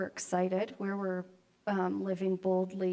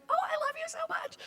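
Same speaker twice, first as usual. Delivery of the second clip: very high, fast gushing coo; doting grandmother squeal; gushing grandma; faster than usual. Retold or imitated. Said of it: "I'm like".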